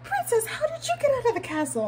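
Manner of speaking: high, sweet fairy-tale greeting; cast member voice. Retold or imitated. Cast member asking the princess how she escaped, high and sweet. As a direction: ask it like a storybook courtier. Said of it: "He was like".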